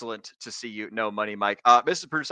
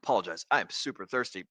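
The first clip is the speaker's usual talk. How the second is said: normal talking voice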